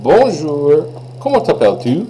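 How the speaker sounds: friendly greeting in French, rising at end; greeting a stranger. Shown as a demonstration. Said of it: "So I might say".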